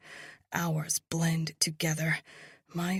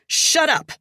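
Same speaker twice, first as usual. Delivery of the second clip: high, loud furious snap; angry snap; 12 semitones higher, 12 dB louder than usual. A narrator's reading. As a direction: snap at him in rage. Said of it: "I snap".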